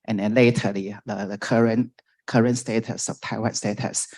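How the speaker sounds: ordinary delivery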